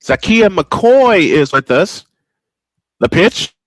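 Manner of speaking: ordinary talk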